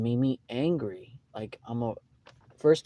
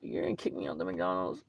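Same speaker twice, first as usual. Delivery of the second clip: low disbelief; 5 semitones lower, 9 dB quieter than usual. Retold or imitated.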